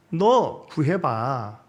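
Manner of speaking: slow mocking taunt. Mocking Jesus on cross.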